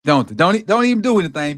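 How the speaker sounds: loud, rising protest; indignant scolding. Real, not acted. Football streamer telling off a celebrating player, indignant scolding. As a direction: cut the celebration down, loud and insistent.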